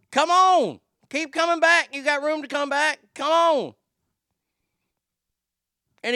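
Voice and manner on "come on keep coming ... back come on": loud, high yelling at a driver to back up; louder and higher, urging him to move; impatient shouting. Retold or imitated. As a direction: bark it across the parking lot, fed up.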